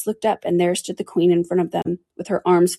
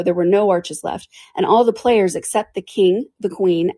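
plain reading voice

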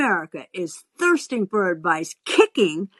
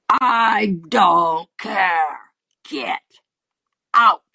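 very slow, drawn-out threat; heavy word-by-word order to leave; menacing; much slower than usual. Acted. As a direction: imitate a menacing man, stretching every word. Man orders her off.

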